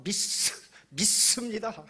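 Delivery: a long, hissed double ㅆ in 믿습니다; exaggerated tense ss. Shown as a demonstration. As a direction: pile extra force on the ss of 믿습니다. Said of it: "막 쌍시옷 많이 붙여서".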